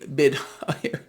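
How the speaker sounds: laughing through the line about oil; can't hold back a chuckle while reporting. Real, not acted. Vlogger to viewers, giggling at rising oil prices. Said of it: "I'm laughing".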